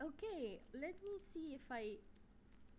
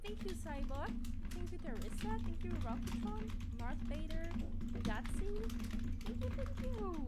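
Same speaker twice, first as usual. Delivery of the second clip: excited thanks; 4 semitones higher, 6 dB louder than usual. Real, not acted. Rapid thanks to many followers.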